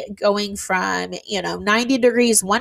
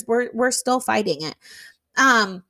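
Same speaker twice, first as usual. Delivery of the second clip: ordinary delivery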